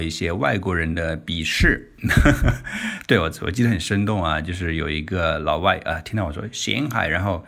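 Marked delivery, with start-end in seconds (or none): none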